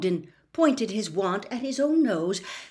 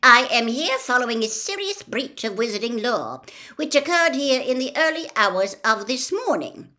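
stern official; 5 semitones higher, 6 dB louder than usual. Acted. Ogden stating official business.

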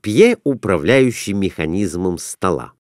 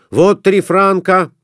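loud, high call; shouting; 9 semitones higher, 4 dB louder than usual. A narrator's reading. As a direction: shout the bet. Merchant shouting bet.

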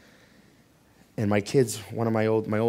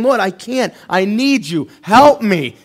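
pleading prayer; 6 semitones higher, 14 dB louder than usual. Acted. Voicing a prayer for help.

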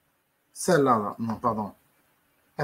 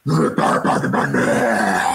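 voix grave et menaçante, plus forte; voix grosse bizarre; 7 semitones lower, 4 dB louder than usual. Acted. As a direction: gronde comme une poupée maléfique. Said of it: "Une voix bizarre". (grosse voix grave façon Chucky)

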